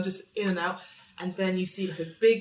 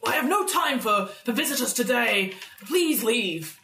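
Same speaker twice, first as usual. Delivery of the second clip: higher-pitched, imperious dismissal of visitors; Grandmaster's booming voice; 5 semitones higher than usual. Acted. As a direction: send the intruders away grandly. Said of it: "and the voice says".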